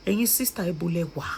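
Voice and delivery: ordinary delivery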